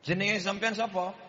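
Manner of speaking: father switching to Javanese to quiz the third suitor; Javanese-speaking father. Retold or imitated. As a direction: ask it Javanese village style. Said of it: "Siji, loro, telu".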